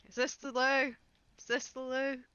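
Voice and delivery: high, sing-out question; calling at doors. Acted. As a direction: call through one door after another. Character calls while knocking on doors.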